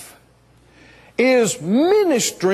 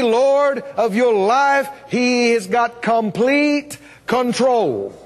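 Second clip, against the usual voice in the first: normal talking voice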